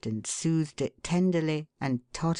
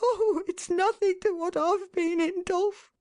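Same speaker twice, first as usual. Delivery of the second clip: much higher wife's voice, mildly grieving; a wife overcome, pleading, understated grief; burst of grief. A narrator's reading. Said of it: "cried his wife in a great burst of grief".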